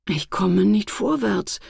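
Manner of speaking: slow, mild moaning complaint; a lightly voiced tired wail; whining lament. A narrator's reading. Stuck Alltag moans that he cannot move forward, mildly whiny. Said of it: "jammerte er".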